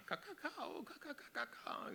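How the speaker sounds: high-pitched cawing bird imitation; mock bird language, far above normal pitch; weird bird noises. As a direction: squawk in rapid, high bird syllables. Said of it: "these weird bird noises".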